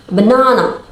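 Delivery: BANANA with the middle syllable stressed; stress on second syllable. Shown as a demonstration. Teacher giving an example of stress, second syllable stressed in "banana". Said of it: "we put stress on the second syllable".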